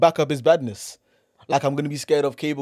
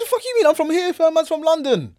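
high-pitched retold protest; mock-outraged retort; indignant comeback; 11 semitones higher than usual. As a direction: fire back as if insulted, pitch jumping up. Retelling his indignant answer.

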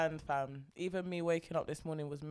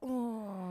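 long mimicked "ooh", pitched up; 4 semitones higher than usual. Co-host gives the artist clue, long imitated ooh. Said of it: "D double E".

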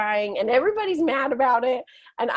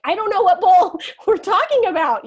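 high, loud, laughing through the words; laughing disbelief; 9 semitones higher, 8 dB louder than usual. Retold or imitated. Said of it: "And I'm like".

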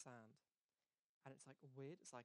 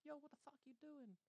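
high-pitched mock outrage; retold outraged protest; 12 semitones higher than usual. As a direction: act out a stunned, high-voiced objection. Retold angry reaction to a waste.

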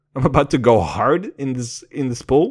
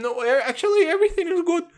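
much higher, prim squeak; faster than usual. Retold or imitated. Teenage him answering the girl, high mock-prim voice. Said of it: "And I'm".

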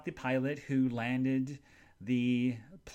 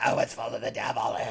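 put-on growly pious condemnation; hammed-up righteous disapproval; mock religious man; 3 semitones lower, 4 dB louder than usual. Religious man condemning a film, mock outrage. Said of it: "if he was a religious person".

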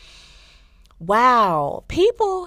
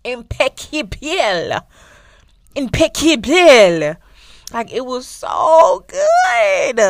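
loud, slow, higher gushing that cracks into a shout; raving; 6 semitones higher, 10 dB louder, much slower than usual. Real, not acted. Telling viewers how good the show was, raving loudly. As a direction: gush over it at full volume.

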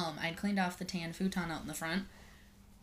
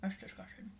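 mouth full; 7 dB quieter than usual. Real, not acted. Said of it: "Sorry, I'm eating a pickle right now".

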